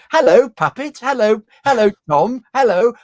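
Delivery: high, loud sing-song hellos; mock a gushing kids' presenter; mock puppet-show greeting. Mocking how he refused to talk to puppets, high gushing hellos. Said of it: "I'm not going to go".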